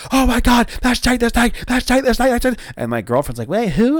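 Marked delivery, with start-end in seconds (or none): 0.1-2.6 high and loud, gushing over and over; reliving the fanboy freakout; starstruck gush. Retold or imitated. Telling viewers how he reacted to Jake the Snake, high starstruck gush. 3.5-4.0 put-on confused girlfriend. Girlfriend voiced in retold story.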